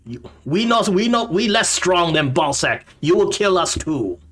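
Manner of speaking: high-pitched goblin protest; wary goblin. Acted. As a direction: object warily in a goblin voice. Goblin fears the party.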